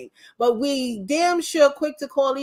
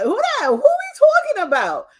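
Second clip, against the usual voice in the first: higher, louder mock disbelief; mock incredulous kids; 11 semitones higher, 5 dB louder than usual. Kids shrug off names from history, higher mock disbelief. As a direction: ham up the kids' puzzlement, rising. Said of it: "they're like".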